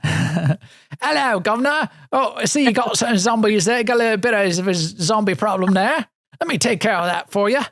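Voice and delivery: put-on a joking, terrible British accent; bad British accent. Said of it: "speaking in a bad British accent".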